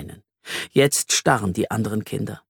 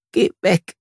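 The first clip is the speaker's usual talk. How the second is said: sobbing; 7 semitones higher than usual. A narrator's reading. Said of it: "schluchzt Flori".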